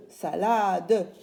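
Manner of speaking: "salade" with a stretched "la" and a voiced final "de"; syllables sounded out. Shown as a demonstration. Teacher repeating for children, "salade" stretched syllable by syllable. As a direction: draw it out as sa-laa-deu, "salade". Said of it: "Et je répète".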